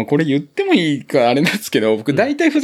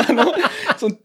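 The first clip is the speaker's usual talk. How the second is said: ordinary talk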